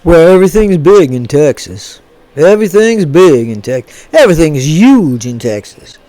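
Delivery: low, put-on Texas voice; Texas accent. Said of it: "That's not a bad accent for that".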